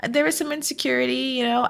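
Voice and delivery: high, breezy fake calm; mock nonchalant. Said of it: "trying to be like".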